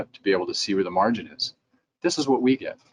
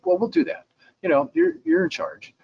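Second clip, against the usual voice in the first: much higher mock-compliant reply; high, mock agreeable surrender; ironic giving in. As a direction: concede in a high voice, clearly unconvinced. Lab's ironic reply to dentist.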